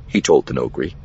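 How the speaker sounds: ordinary delivery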